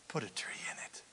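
unvoiced whisper of the line. Shown as a demonstration. Preacher repeating God's remedy to the congregation, whispered. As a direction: breathe it like a secret.

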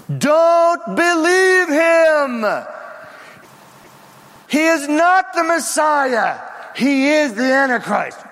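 high, long-drawn prophetic cry; wailing shout. Acted. Two witnesses warning the temple crowd, wailing shout. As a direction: wail the warning from far across the crowd. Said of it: "suddenly there is a wail".

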